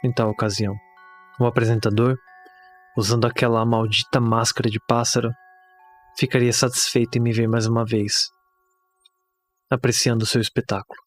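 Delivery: grave, pausada horror narration; narração de conto de terror. Acted. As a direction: narrate a bleak horror tale, measured, with long pauses. (narração sombria de conto de terror)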